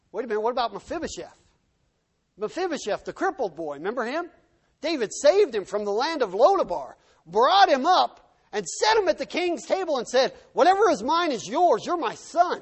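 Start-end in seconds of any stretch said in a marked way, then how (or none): 4.8-12.6 loud, high, driving sermon delivery; impassioned preaching. Real, not acted. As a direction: build the rescue with full pulpit force. Preaches David's rescue at full force.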